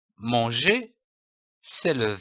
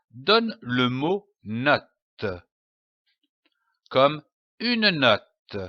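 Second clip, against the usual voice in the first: slow clear teaching; slower than usual. Shown as a demonstration. Teacher stresses the new word.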